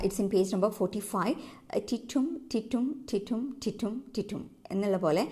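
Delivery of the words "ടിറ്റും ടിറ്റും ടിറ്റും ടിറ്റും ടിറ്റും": "ടിറ്റും" repeated in a steady da-DUM pulse; iambic rhythm. Shown as a demonstration. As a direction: chant "ടിറ്റും" as an even beat, light ടി then stressed റ്റും. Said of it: "ഇങ്ങനെയാണ് rhythm വരുന്നത്". ("ടിറ്റും" താളത്തിൽ, രണ്ടാം ഭാഗത്തിന് ഊന്നൽ നൽകി)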